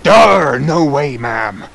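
loud, gruff scoffing no; gruff refusal. Acted. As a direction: scoff at the request. Captain NPC refusal.

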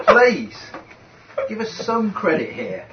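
mock indignant. Real, not acted.

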